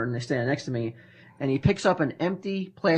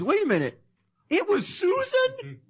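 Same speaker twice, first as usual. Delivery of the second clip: alarmed surprise. Retold or imitated. Ming realizing the clerk is his friend, high startled surprise. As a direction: stretch it out in sudden alarm. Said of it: "he goes".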